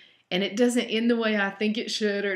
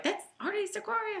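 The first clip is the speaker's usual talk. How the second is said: imitating reader; 13 semitones higher, 3 dB quieter than usual. Reader's reply quoted.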